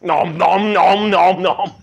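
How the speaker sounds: greedy nom nom chomping; mock eating noises. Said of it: "and she's like".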